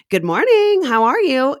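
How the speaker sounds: high, loud chirpy barista hello; perky greeting. Acted. Barista greeting a customer, perky. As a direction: pipe up super perky. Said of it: "I'm a perky as fuck".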